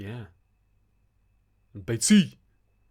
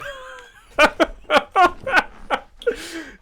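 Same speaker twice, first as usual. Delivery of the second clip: long loud laughing fit; 10 dB louder than usual. Real, not acted. Laughing over old nickname.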